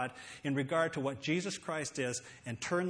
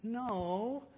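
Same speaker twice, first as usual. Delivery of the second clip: half-hearted no; 7 semitones higher than usual. Shown as a demonstration. Refusing a beggar without meaning it, half-hearted. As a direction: say no like you don't mean it. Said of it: "like you don't mean it".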